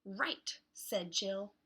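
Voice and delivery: lively picture-book read with voiced characters; reading to children. Teacher reading a beach book aloud to kids, lively. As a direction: read it like story time for little ones.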